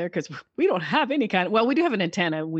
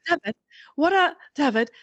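higher, pretentious put-on accent; Catherine O'Hara; 6 semitones higher than usual. Showing friends how an actress talks, pretentious impression. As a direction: imitate an affected actress calling for David. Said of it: "she talks like this".

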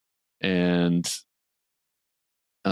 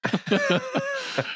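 real laugh. Real, not acted. Laughing at her own impression.

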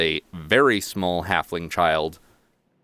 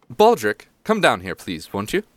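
raised, louder summons; calling out; 3 semitones higher, 4 dB louder than usual. Acted. Lord summons his child from the stairs, calling out. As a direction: call up to a child upstairs.

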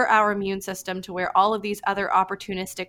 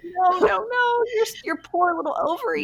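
mock tearful pleading; 11 semitones higher than usual. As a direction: plead as if about to cry, pitched way up. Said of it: "I just wanted to cry".

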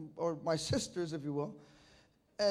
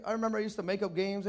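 ordinary talk

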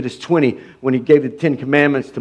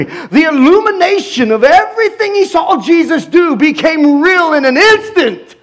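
much higher, loud building preaching; rising fervor; 10 semitones higher, 3 dB louder than usual. Real, not acted. Preacher building to a climax, higher fervent cry. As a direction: climb into full fervor.

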